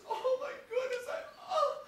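high, loud gasping laughter; euphoric laughing. Retold or imitated. Hiker overwhelmed by the rainbow, laughing helplessly. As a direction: laugh breathless with joy. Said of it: "and he's dying".

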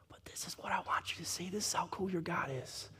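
breathy hushed aside to the church; whisper. Real, not acted. Pastor hushing the congregation before the big point. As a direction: lean in and let them in on it. Leaning in to share secret.

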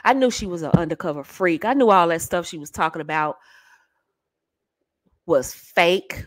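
low, knowing male imitation; gloating man. Man who got her talking freaky, low gloating. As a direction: drop low and gloat, as if proven right. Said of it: "He's going".

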